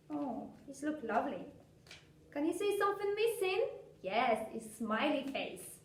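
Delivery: ordinary talk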